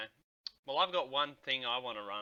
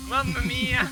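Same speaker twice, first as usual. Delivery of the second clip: loud, high laughing Italian exclamation; Italian accent; 10 semitones higher, 9 dB louder, slower than usual. Pretend. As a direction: cry it like a jolly pizza chef. Burnt pizza car, Italian exclamation.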